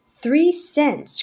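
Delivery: put-on girl crying out a tiny bid; desperate cry. A narrator's reading. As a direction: plead with the auctioneer. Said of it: "cried Laurie desperately".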